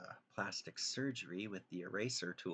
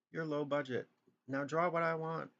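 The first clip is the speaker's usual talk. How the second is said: higher, pushy mock voice of a rude viewer; mocking a demanding viewer; 8 semitones higher, 4 dB louder than usual. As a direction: mimic the guy bossing you around, pitched up. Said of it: "'Cause like that guy said".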